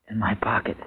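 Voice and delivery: faint dying whisper. Acted. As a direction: barely breathe the words out. Husband confesses taking pills, dying.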